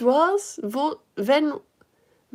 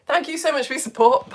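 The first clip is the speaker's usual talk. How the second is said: ordinary talk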